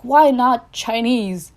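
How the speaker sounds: loud, slow, carefree question; a spur-of-the-moment shrug, said loud; excited. Retold or imitated. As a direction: blurt it out like a sudden whim. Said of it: "but I was like".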